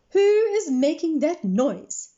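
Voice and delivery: loud, high, slow demand. Acted. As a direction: call out sharply across the room. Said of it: "she demanded".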